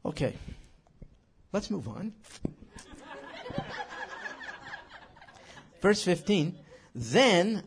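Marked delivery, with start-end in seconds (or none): 2.3-5.0 high laugh. Real, not acted. Laughs after moving on.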